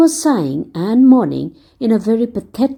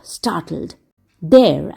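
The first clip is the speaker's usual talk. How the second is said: ordinary delivery, nothing marked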